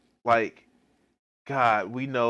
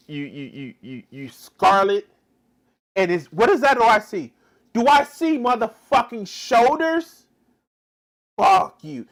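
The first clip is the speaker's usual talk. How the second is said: mock outraged puritan; 6 semitones higher, 8 dB louder than usual. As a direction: rant like a scandalized zealot shaming a woman. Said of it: "Watch this, watch this".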